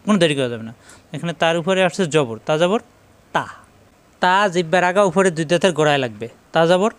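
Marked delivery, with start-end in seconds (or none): 3.4-3.5 short 'তা', cut off without lingering; quick short ta. Shown as a demonstration. Teacher showing learners a harakat must be quick, short 'তা'. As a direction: say 'তা' quickly, no drawn-out vowel. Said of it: "হরকতের উচ্চারণ দ্রুত করতে হবে".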